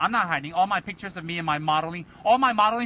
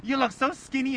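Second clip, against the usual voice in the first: slow, higher gushing praise; gushing compliment; 5 semitones higher than usual. Retold or imitated. Locals praising his weight loss, gushing. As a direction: gush over how skinny he looks. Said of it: "that's like".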